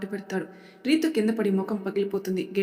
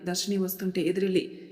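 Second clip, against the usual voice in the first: ordinary delivery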